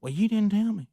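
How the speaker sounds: high, pleading protest; whiny retold complaint. Gary protesting to the host, high and whiny. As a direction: pitch it up like a sulking friend.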